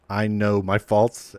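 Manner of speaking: usual speaking voice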